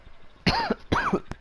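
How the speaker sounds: high, squeaky fake cough; fake cough sound effect. Shown as a demonstration. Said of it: "Cough sound effect".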